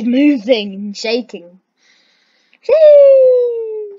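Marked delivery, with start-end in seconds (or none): 2.7-4.0 high, loud gleeful squeal; gleeful whee. Real, not acted. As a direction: squeal it like a rollercoaster ride. Riding the earthquake, joyful cry.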